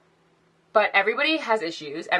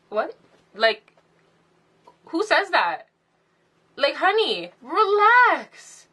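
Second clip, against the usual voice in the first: sassy disbelief; 9 semitones higher, slower than usual. Retold or imitated. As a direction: squeal out a disbelieving honey, relax. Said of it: "I was like".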